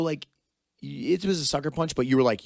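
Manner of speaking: usual speaking voice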